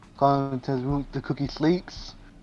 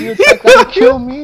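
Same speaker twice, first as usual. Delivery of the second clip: high, loud betrayed outcry; outraged shriek; 12 dB louder than usual. Real, not acted. Streamer accusing friends' tributes, shrieking in mock outrage. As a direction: wail at your friends' betrayal.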